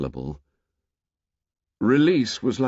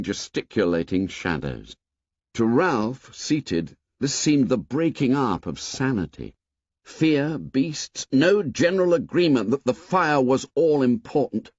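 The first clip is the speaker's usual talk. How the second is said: plain reading voice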